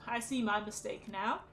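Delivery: usual speaking voice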